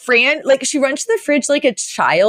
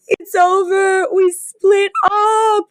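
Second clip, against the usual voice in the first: mock sobbing, much higher, slow and drawn out; imitating sobbing; 12 semitones higher, much slower than usual. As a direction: wail it like Fran crying to her mother. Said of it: "Fran is just sobbing".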